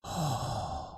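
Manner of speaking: breathy heart sound. Shown as a demonstration. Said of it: "we call the heart fire coming out".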